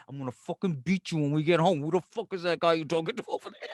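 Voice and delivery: low, muttered mock menace; quiet, acted seething; jealous threat. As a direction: threaten under your breath as the suspicious husband. Voicing jealous husband threatening wife.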